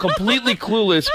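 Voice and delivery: normal talking voice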